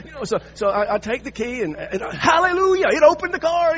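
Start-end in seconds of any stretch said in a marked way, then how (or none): 2.2-3.8 much higher, louder joyful burst; hallelujah relief. Real, not acted. Telling the congregation the key worked, joyful burst. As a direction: cheer like a small miracle happened.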